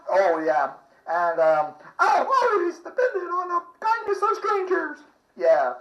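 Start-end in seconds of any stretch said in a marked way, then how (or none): 2.0-5.0 Southern belle voice. Retold or imitated. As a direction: play a swooning movie heroine, pitched way up. Streetcar Named Desire quote.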